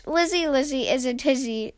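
ordinary delivery, nothing marked

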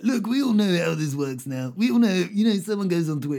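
light, bouncy comedian patter; lightly voiced stand-up comic; Michael McIntyre impression. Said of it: "I almost sound like Michael McIntyre there".

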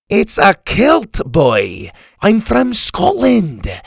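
loud, high, drawn-out Scottish indignation; crotchety Scotsman, indignant. Acted. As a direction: correct the boy like an affronted Scot. Story's crotchety old Scottish man.

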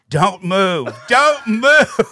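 high-pitched mock cry breaking into laughter; hysterical laughing mom. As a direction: squeal it, building, as if cracking up. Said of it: "she starts laughing hysterically".

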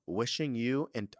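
normal talking voice

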